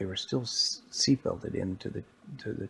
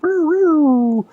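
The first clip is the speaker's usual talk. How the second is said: very high, very loud siren wail; police siren imitation; 14 dB louder than usual. Storyteller as police arrive, loud wailing siren noise.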